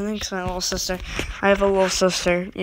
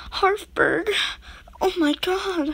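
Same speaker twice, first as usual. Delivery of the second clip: surprised; 10 semitones higher, slower than usual. Real, not acted. Finds Hartford horn.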